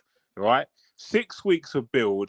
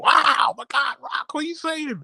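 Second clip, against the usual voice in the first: excitable Pat. Retold or imitated. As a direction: squeal like a hyped talk-show host. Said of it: "Pat is all excitable".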